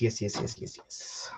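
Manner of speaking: fading into a creepy whisper; unvoiced spooky yes chant. Real, not acted. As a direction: breathe the yeses out eerily. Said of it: "That was kinda creepy how I did that".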